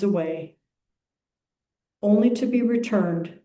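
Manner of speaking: unhurried, measured phrases with long pauses; calm, spacious meditation reading; guided meditation. Acted. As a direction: lead the congregation into stillness, phrase by phrase.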